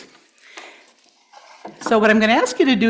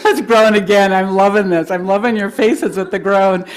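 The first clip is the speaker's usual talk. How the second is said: laughing; 6 dB louder than usual. Real, not acted.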